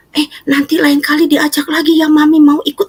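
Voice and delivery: high excited voicing of mother; eager mom. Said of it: "katanya gitu".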